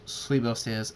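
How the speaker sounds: plain reading voice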